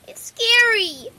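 put-on high, loud, drawn-out childish protest; squeaky little-girl whine; squeaky filly. A narrator's reading. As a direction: stretch it out like a scared little kid. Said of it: "her voice squeaking at the last word".

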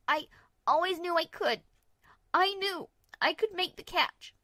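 high, loud voice of a shaken filly; filly in shock. Acted. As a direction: blurt it out, high and dazed.

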